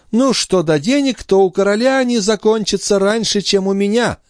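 high-pitched, testy retort; haughty countess. A narrator's reading. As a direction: answer dismissively in a high lady's voice. Countess answers about money.

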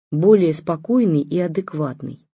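ordinary delivery

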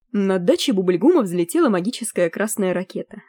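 usual speaking voice

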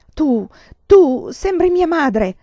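high, loud, slow stammering outburst; furious shout. A narrator's reading. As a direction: explode at him, voice cracking up high. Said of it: "gridò".

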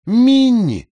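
high, loud call of Minnie; calls her name like the nagging sister; sister's call. Acted. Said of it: "сказала она".

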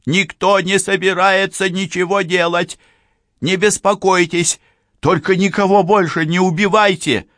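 high, loud urgent appeal; shouted reassurance. A narrator's reading. Policewoman pleading with the hostage-taker not to kill, shouted reassurance. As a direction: shout it through the door to calm him. Policewoman calling to killer behind door.

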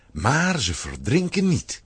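ordinary talk, nothing marked

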